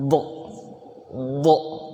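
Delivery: "Do" as a heavy, short isolated syllable; do bibir ditarik. Shown as a demonstration. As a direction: press out "Do" with drawn lips. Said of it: "itu sampai bibirnya ditarik". ("Do" tebal dengan bibir ditarik)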